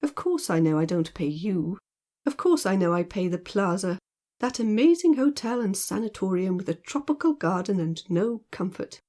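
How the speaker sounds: ordinary delivery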